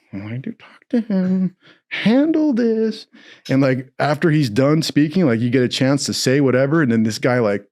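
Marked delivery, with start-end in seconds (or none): 0.1-3.1 higher, slowed, whiny plea; mock pleading. Defendant silently urging his lawyer, high and slow. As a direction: whine at the lawyer to handle it. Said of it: "And I'm like".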